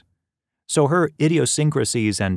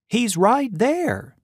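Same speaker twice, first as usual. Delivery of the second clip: much higher, slow fretful plea; fretting; 12 semitones higher, much slower than usual. Acted. Mother fretting to her son at the window, much higher and slow. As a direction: stretch it out, pleading and worried. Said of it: "she fretted".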